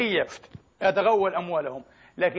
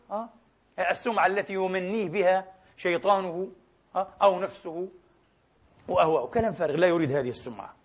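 ordinary talk

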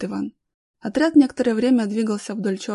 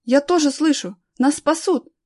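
high, loud, hopeful cry; acted excited boy; animated exclamation; 6 semitones higher, 6 dB louder than usual. A narrator's reading. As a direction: burst out with hope of rescue. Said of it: "воскликнул Данил, оживившись".

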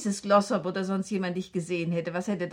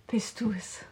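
hushed, scared call into the dark; whispering nervously alone in the dark house; frightened whisper. Acted. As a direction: barely breathe it out, afraid of the answer.

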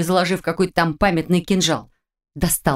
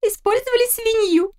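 put-on much higher, giggly teenage girl; girlish voice. A narrator's reading. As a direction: tease it like a mischievous daughter. Daughter's punchline.

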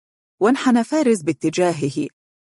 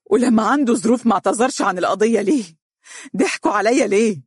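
raised voice on the edge of tears; crying out; 5 semitones higher than usual. Acted. Broken father demanding answers, tearful cry. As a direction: shout it through rising sobs. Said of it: "صاح عبد القادر ببكاء".

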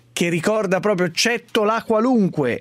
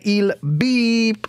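slow emphatic; 4 semitones higher than usual. Shown as a demonstration. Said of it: "Con calma".

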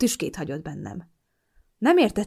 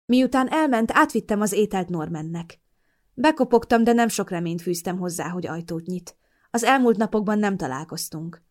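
plain reading voice

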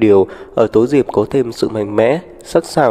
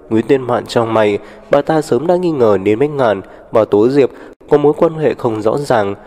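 plain reading voice

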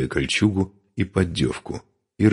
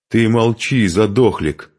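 high, louder retort; snapping back; 6 semitones higher, 5 dB louder, slower than usual. A narrator's reading. Woman silencing the Khazar's remark, snapping back. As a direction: shut him up crossly. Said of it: "огрызнулась Нурманка".

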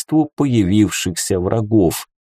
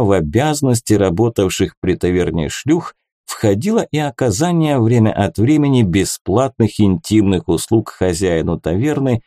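plain reading voice

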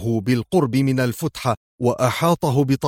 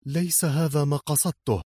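softer, higher, embarrassed protest; flustered mumble; 4 semitones higher, 5 dB quieter than usual. A narrator's reading. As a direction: mumble it in confusion. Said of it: "غمغمت في ارتباك". (بغمغمة مرتبكة)

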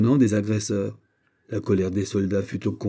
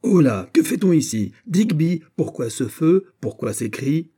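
raised, ringing commanding voice; sonorous challenge; 7 semitones higher, 5 dB louder than usual. A narrator's reading. General challenges his soldiers about fire and cries, sonorous. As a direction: demand answers with authority. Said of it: "Puis, d'une voix sonore".

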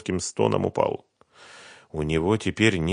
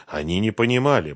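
usual speaking voice

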